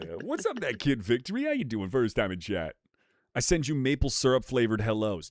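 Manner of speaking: usual speaking voice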